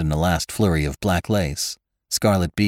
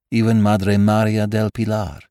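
ordinary delivery

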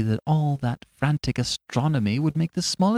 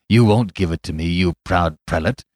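gloating cry; 3 semitones higher, 5 dB louder than usual. Acted. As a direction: crow over the fool, building to a shout. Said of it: "he cried".

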